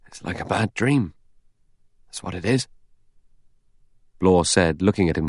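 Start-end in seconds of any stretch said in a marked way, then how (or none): 0.1-2.7 mildly hoarse, subdued dismay. Acted. Rogers wiping his brow, a little hoarse. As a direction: say it with a slightly rough throat. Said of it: "He said hoarsely".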